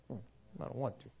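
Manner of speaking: put-on soft sulky child's refusal; a quiet pouting hmm, then I don't want to; sulky mumble. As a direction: mutter it like a stubborn kid. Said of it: "It's like".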